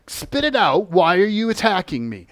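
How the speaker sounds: loud, insistent interrogation; shouting at the captured being; forceful demand. Retold or imitated. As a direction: demand the answer, loud and firm.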